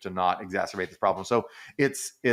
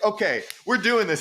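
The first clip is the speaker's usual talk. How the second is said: much higher, louder, ready to fight; mock fired-up; 11 semitones higher, 4 dB louder than usual. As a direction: launch it like you're getting up to brawl. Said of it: "And he's just like".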